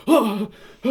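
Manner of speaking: loud, high startled "ah"; sharp gasp as if rammed from behind; panicked gasp. Retold or imitated. Retelling the driver bumped by a truck, panicked gasp.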